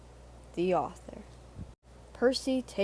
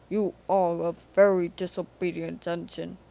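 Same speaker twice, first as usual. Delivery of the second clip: louder, stern rebuke; stern Fat Controller; 2 semitones lower, 6 dB louder than usual. A narrator's reading. The Fat Controller scolds Percy in the sea, louder. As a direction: scold the engine gravely.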